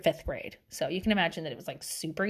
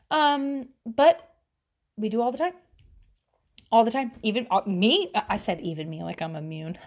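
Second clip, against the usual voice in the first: ordinary talk, nothing marked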